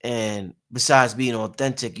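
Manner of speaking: ordinary delivery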